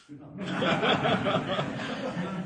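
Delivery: short amused laugh. Real, not acted. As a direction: laughs at his own answer. Laughs at own answer.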